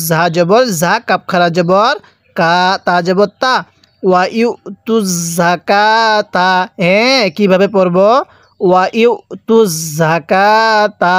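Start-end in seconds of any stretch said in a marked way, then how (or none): 4.0-6.5 slow ওয়া ইউ তুজ জাকাতা with long কা; drawn-out recitation. Shown as a demonstration. Teacher joins the syllables for students, slow recitation. 8.6-11.2 slow ওয়া ইউ তুজ জাকাতা with long কা; drawn-out recitation. Shown as a demonstration. Teacher joins the syllables for students, slow recitation. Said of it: "এরকমভাবে শিখব".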